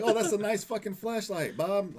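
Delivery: high, loud mock cop eyeing loot; greedy cop. Mocking a cop admiring a colleague's flashlight, high and loud. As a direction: play a sly thieving cop.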